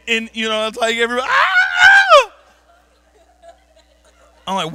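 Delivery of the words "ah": very high, loud scream; mock crowd scream. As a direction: shriek with the whole cabin. Everybody screams in relief.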